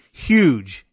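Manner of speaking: "Huge" punched loud; all caps emphasis. Shown as a demonstration. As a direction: slam the word "Huge" like capitals. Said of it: "and all caps".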